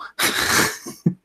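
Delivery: breathy, mostly voiceless laughter; real laugh. Real, not acted. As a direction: break off into a helpless, breathy laugh. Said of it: "Sorry, I'm laughing".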